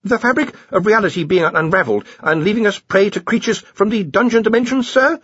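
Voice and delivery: high, hesitant subordinate; mumbling Stibbons. A narrator's reading. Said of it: "mumbled Stibbons".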